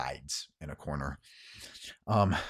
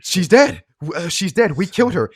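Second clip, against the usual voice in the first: loud, high, urgent cry; frantic outburst; 10 semitones higher, 12 dB louder than usual. Acted. Othello breaks news of a death, frantic.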